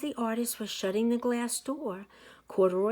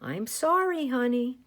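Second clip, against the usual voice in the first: sorry mother; 5 semitones higher, 5 dB louder than usual. A narrator's reading. Mother comforts Lisa.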